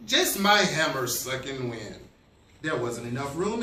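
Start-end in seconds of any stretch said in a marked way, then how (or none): 0.1-2.0 low character voice at full volume; deep voice. A narrator's reading. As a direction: drop the pitch, keep it firmly voiced. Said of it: "came a deep voice".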